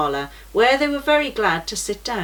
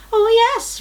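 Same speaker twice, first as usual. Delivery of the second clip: 1 bright reassurance; 6 dB louder than usual. A narrator's reading. Gaius cheerfully assures Matthew.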